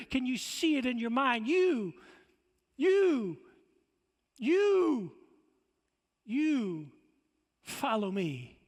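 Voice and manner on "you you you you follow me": jab the word "you" again and again; repeated pointed you. Shown as a demonstration. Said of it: "Jesus pointing the finger".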